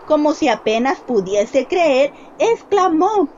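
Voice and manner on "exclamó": ordinary delivery, nothing marked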